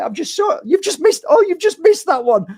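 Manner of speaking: high-pitched, frustrated voice of the departed; exasperated spirit. Retold or imitated. Spirit father exasperated his grieving daughter misses signs, high-pitched. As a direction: squeal it like a spirit tearing its hair out. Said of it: "I'm like".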